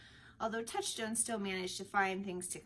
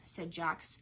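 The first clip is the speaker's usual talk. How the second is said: ordinary delivery, nothing marked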